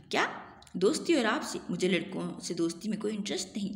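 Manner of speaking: plain reading voice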